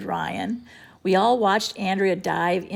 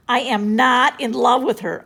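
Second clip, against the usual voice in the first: louder insistent denial; insisting; 4 semitones higher, 7 dB louder than usual. A narrator's reading. Boy denying he loves Andrea, insisting loudly. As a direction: deny it hard. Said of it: "I insisted".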